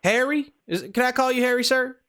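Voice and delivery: put-on prank caller in a Southern accent. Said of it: "we're doing the Southern accent".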